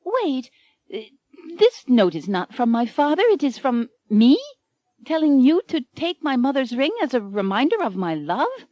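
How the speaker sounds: high, doubting heroine voice; wary heroine. Acted. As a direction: ask warily, still tied up. Anne reads the note.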